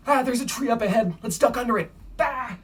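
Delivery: high, urgent play-acted warning; playful mock panic; pretend alarm. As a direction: call out a make-believe obstacle, loud and high. Play-acts dodging a tree on the run.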